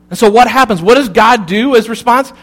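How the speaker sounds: ordinary talk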